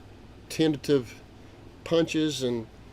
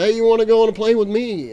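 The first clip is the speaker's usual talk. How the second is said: high, loud call to come along; loud invitation; 9 semitones higher, 9 dB louder than usual. Retold or imitated. The rich pilot loudly invites grandpa aboard. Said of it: "'cause he's talking real loud".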